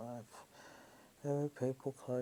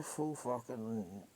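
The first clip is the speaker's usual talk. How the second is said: ordinary delivery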